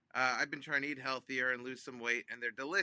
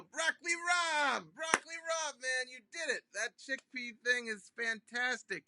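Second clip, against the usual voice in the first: excited shout; 11 semitones higher than usual. Retold or imitated. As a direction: yell his nickname like a long-lost buddy. Excited greeting of old friend on phone.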